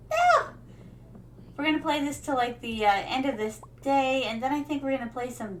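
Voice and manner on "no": high squeak of panic; panicked squeal. Real, not acted. Streamer fleeing in the game, squeaking in panic. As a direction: squeal as it catches you.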